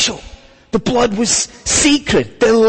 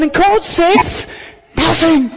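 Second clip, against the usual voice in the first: raised emphatic; 8 semitones higher than usual. Acted. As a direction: say "and God says nothing" higher and stronger, landing hard on "nothing". God's silence, restated with force.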